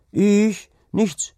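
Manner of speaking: put-on high, loud boyish squeak; startled boy. A narrator's reading. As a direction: answer high and caught off guard. Startled boy answers the farmhand.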